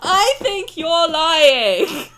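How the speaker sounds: slow, loud, laughing through it; laughing accusation. Real, not acted. Streamer accusing Silas of lying, slow laughing accusation. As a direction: drag it out while laughing.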